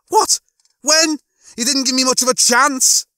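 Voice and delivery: very high, loud, indignant disbelief; startled squeak turning to complaint; shocked protest. A narrator's reading. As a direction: blurt it out in rising alarm. Lister stunned, protests no warning.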